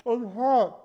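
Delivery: high, through a scalding mouthful; mouth full of hot food. Real, not acted. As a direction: talk past a burning bite. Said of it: "steam coming out of your mouth".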